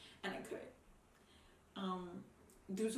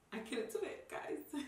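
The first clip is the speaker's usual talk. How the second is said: higher, breaking voice through tears; crying; 6 semitones higher than usual. Real, not acted. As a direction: voice cracks as she admits it. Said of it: "I didn't expect to get that emotional".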